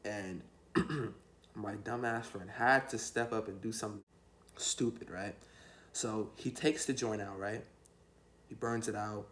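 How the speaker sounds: normal talking voice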